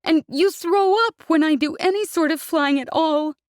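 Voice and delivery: tearful outburst; sobbing. Acted. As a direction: sob out the words. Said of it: "she sobbed".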